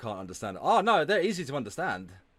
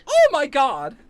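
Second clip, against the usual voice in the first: high, loud, appalled exclamation; loud scandalized gasp of words; shocked outburst; 11 semitones higher, 9 dB louder, slower than usual. Real, not acted. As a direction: cry out in disbelief. Shocked at a cruel remark played.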